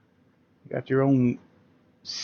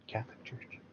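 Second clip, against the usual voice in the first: whispered, half-joking gasp of horror; sarcastic hushed whisper; mock whisper; 17 dB quieter than usual. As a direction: breathe it like a scandalized secret. Mock outrage at the Church.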